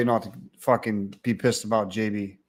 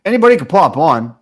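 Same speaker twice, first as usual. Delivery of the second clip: loud exclaim; 3 semitones higher, 7 dB louder than usual. Real, not acted. Exclaiming to the chat.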